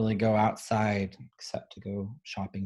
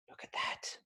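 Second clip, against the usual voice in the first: whispered look at that; 14 dB quieter than usual. Acted. Teacher whispering to children over a scared girl's picture. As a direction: hush it like a secret.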